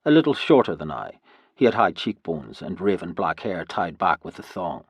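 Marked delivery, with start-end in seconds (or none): none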